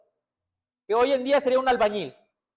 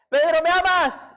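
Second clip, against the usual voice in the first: raised, high, loud question; insistent demand; 7 semitones higher, 6 dB louder than usual. Retold or imitated. Jesus asks Peter the third time, insistent and loud. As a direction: press the question home loudly.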